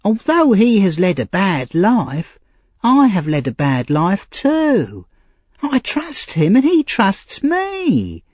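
put-on high, slow, earnest young woman; Nancy, pleading loyalty. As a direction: plead for the old man you trust.

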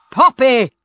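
father's loud rebuke; angry shout. A narrator's reading. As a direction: call her sharply to order. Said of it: "shouted her father".

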